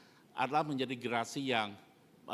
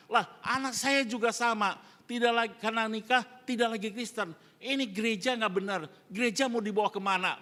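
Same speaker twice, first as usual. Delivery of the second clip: louder, higher mock complaint from an offended old churchman; a retold angry grumble, raised and pushed; imitated indignant grumble; 7 semitones higher, 5 dB louder than usual. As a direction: rant at the church as the upset father. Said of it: "dia ngomelnya apa?".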